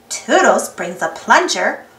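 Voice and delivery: ordinary delivery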